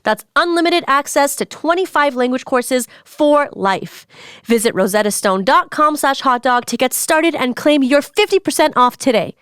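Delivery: upbeat sponsor read. Acted. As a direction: read it like a radio ad. Reads a sponsor ad.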